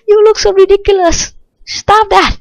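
loud, high-pitched exclamation; yelling at zombie. Real, not acted. Player telling off a ridiculous zombie, high loud yell. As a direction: shout high at a ridiculous opponent.

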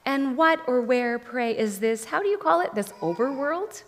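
high, mocking enchantress; witch voice. A narrator's reading. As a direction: toy with him sweetly.

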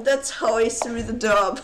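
ordinary talk, nothing marked

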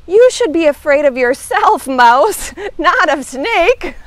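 loud, chuckling tease; amused teasing. Acted. Hedgehog peering down at fallen Mouse, laughing. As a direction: laugh at a friend's silly mishap.

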